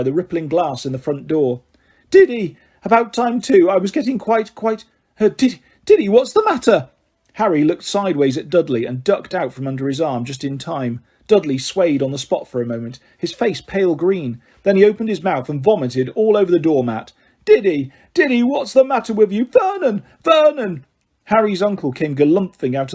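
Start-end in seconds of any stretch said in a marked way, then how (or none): 2.1-6.9 higher, shrill-edged doting mum voice; a high-strung mother fussing over her boy; fussy mother. Acted. Aunt Petunia greeting her son. 17.5-20.8 high, loud panicked cries for her husband; a frightened mother shrieking for help; panicked calling. Acted.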